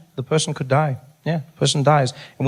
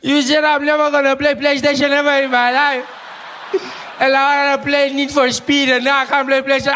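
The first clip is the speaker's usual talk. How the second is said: crying little brother; 12 semitones higher than usual. Retold or imitated. Brother crying over lost PlayStation, wailing mock sobs. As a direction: bawl the words like a hammed-up tantruming child. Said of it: "He starts crying".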